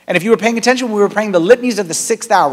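ordinary talk

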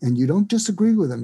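normal talking voice

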